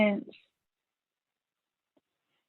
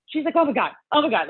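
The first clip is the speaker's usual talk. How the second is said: higher-pitched imitation of a frantic friend; mock excited freak-out; 8 semitones higher, faster than usual. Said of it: "like, freaking out".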